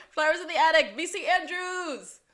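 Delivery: thrilled to catch the reference; excited recognition. Real, not acted. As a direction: exclaim it delightedly.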